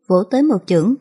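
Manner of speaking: usual speaking voice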